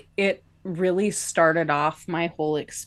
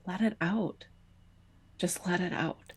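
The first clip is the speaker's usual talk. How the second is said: softer, hushed coaxing; soft encouragement; 9 dB quieter than usual. Retold or imitated. The girl beside her softly urging her to let it out. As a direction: coax her gently to let go. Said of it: "she said".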